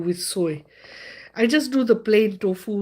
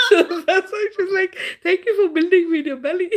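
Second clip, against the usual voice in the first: high, fast, laughing; quoting her daughter through laughter; laughing while quoting; 11 semitones higher, 4 dB louder, faster than usual. Real, not acted. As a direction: giggle through the quote. Laughing retelling.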